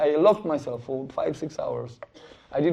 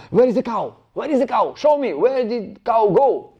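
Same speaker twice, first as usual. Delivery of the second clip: loud, high shouting butcher; angry demand; 8 semitones higher, 8 dB louder than usual. Acted. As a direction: bark it out, hunting for the cow.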